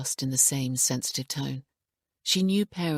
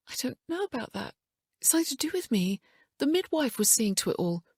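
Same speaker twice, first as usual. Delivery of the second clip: distressed; 10 semitones higher than usual. A narrator's reading. Emma defensive, distressed.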